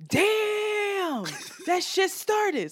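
high, drawn-out mimicked exclamation; obnoxious. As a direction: whoop it out as the amazed onlooker. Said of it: "so obnoxious".

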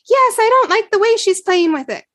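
put-on sulky kid whining about her sister; child's complaint. Jen voiced as small child.

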